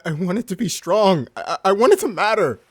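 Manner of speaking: loud, high anguished cry; anguished outburst. Acted. Captain confessing his ambition to Lily. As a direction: force the confession out.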